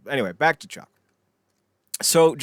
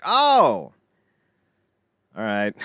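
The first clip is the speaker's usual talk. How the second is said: mock surprise; 8 dB louder, much slower than usual. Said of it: "And it's like".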